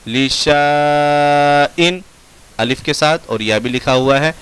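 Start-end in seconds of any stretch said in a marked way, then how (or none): 0.1-2.0 लिशाइन with शा held very long; slow chanted लिशाइन, alif sounded; written-form reading. Shown as a demonstration. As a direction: stretch the आ of लिशाइन, then a short इन. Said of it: "लिखने का तरीका".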